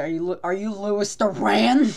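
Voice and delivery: high, loud, rising question; loud starstruck ask; excited fan greeting. Retold or imitated. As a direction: call out to a celebrity you just spotted. Acting out friend greeting a celebrity.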